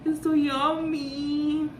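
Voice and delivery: long, drawn-out high foodie rave; delighted. Real, not acted. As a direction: stretch every word in real delight at the taste. Tasting food she enjoys. (lami kaayo, taas ug binira nga tingog)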